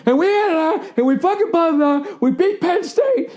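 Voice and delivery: very high, squeaky mock voice, slowing at the end; high cartoonish mimicry of a friend's bragging; mocking bragging fan. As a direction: parody a whiny fan gloating about a win. Mocking friend bragging about football.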